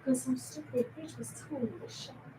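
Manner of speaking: hushed unvoiced whisper. Real, not acted. Whispering to the guys about the window. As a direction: whisper it so no one hears.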